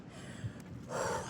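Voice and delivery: long breathy sigh; heavy exhausted sigh. Real, not acted. Runner sighing after a brutal stretch. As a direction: let out a spent sigh.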